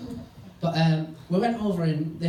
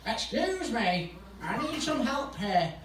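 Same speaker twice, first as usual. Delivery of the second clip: higher, slow, softer plea; mock plea for help; 6 semitones higher, 5 dB quieter, slower than usual. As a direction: draw out a feeble call for help. Stranger calls out for help.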